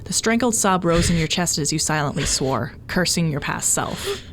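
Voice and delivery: ordinary delivery, nothing marked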